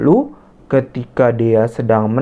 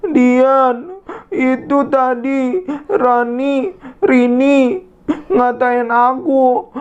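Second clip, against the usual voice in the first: higher, teary and slow; tearful girl; 12 semitones higher, slower than usual. Acted. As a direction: tell it between sobs. Dea crying to her friend. (menangis sambil mengadu)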